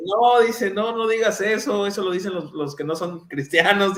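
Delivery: high, laughing friend's no; friend's warning. Retold or imitated. Cuban friend warns the pastor off the greeting, high and laughing. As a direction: play the friend stopping him.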